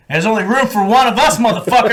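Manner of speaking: high, challenging sergeant voice; imitated gunnery sergeant. Sergeant Sanchez confronting his namesake, mock challenge. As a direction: challenge him like a territorial sergeant. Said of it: "He's like".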